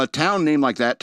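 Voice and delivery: ordinary delivery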